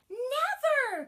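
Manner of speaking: high, loud cry of refusal; shouted. Acted. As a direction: scream it like a tantrum. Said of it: "cried the princess again".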